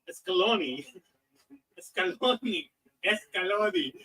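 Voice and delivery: Scaloni stretched out loud, with an extra leading "es"; mock the odd "Scaloni", loud and drawn out as "es-ka-LO-ni"; mimicked mispronunciation of Scaloni. Friend teasing the host over his lineup reading, Scaloni mispronounced. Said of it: "You said, like, I don't even know what you said".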